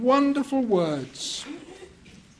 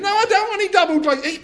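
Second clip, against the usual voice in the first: high, loud, fast brush-off; harried refusal; 9 semitones higher, 5 dB louder, much faster than usual. Acted. Husband rushing the salesman away, loud and fast. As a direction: rattle it off to get rid of him.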